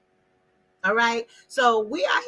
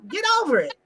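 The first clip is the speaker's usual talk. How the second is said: dismissive parent; 6 semitones higher, faster than usual. Retold or imitated. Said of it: "the old us".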